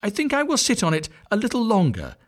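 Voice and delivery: high, firm motherly voice; patient mother duck. A narrator's reading. Mother duck refusing the old duck's advice, high voice. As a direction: insist gently but firmly. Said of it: "said the duck".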